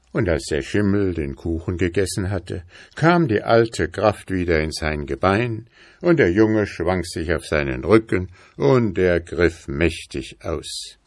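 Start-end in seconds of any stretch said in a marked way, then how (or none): none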